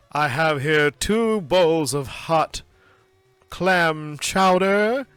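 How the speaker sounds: slow, grand waiter announcing the soup. Retold or imitated. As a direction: announce it like a fancy waiter, drawn out. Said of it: "the waiter comes over and goes".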